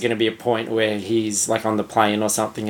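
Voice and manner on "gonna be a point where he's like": usual speaking voice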